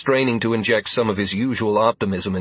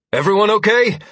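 loud, high call; 11 semitones higher, 6 dB louder than usual. A narrator's reading. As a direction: call across the room. Calling out after the quake.

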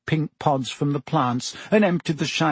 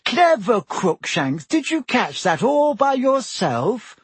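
high, slow sing-song fussing over a pet; cooing praise; 9 semitones higher than usual. A narrator's reading. Hermione praising her cat for its catch, high cooing. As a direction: coo at the cat as if to a baby.

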